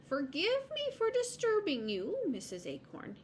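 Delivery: high-pitched, courteous caller; high squirrel voice, falling on the name; polite squirrel. Acted. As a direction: apologise politely in a high storybook voice.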